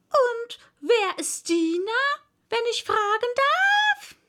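high, loud haughty parrot voice. A narrator's reading. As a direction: demand it like an offended parrot.

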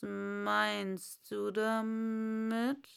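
a stern, drawn-out caterpillar; Raupe. Acted. Raupe voiced by narrator. (langsame, strenge Raupe)

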